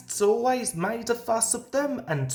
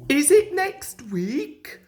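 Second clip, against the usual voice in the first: eager little engines; 7 semitones higher, 4 dB louder than usual. Acted. Twins pestering Thomas each morning.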